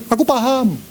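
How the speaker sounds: high, dismissive brush-off; cynical. Shown as a demonstration. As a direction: toss it off as a distracted, cynical husband. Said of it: "Matanya sinis".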